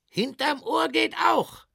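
high, loud fairground sales shout; hawker shouting pitch. Acted. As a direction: sell soap at full volume to the crowd. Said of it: "sagte der Verkäufer".

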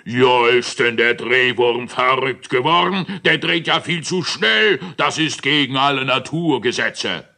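high, loud grumbling giant; scolding giant. Acted. Moon giant scolds the Drehwurm for spinning too fast. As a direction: rant at the spinning worm. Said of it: "was er schimpfte".

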